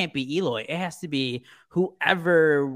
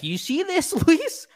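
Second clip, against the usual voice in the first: much higher, exasperated teammate voice, laughing on the name; mock Eloy rant. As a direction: squeak out an annoyed outfielder scolding his teammate. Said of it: "and he's like".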